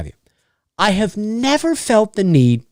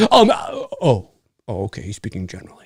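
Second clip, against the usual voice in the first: much lower and much softer, deflated; sheepish backing down; 8 semitones lower, 5 dB quieter, faster than usual. Retold or imitated. Mocking a corrected commenter who gives up, soft. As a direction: let it fizzle out meekly. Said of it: "they go".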